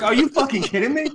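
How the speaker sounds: high, loud disbelieving cry; incredulous outburst. Retold or imitated. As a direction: shout it as if you can't believe it. Retelling reaction to illiterate claim.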